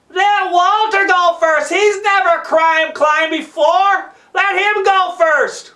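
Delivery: slow, high yell from above; Mario yelling down. Acted. Mario up at the anchor to the climbers, yelling. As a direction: holler it down, drawing the words out. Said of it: "Mario yells down".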